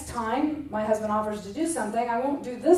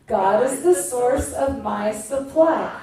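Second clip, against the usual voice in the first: slow, measured affirmation; 5 dB louder, slower than usual. Shown as a demonstration. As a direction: say it slowly like a vow. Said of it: "Say it one more time".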